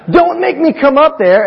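high, loud mom threat; a hammed-up mother's threat; mock mom threat. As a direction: ham up the warning mom. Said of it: "I don't know if those words were said this morning".

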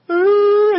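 loud, high mock "ooh"; imitated shocked "ooh". Daughter realizes she punched dad, high alarmed "ooh". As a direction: mimic a child's high gasp of alarm. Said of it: "she went".

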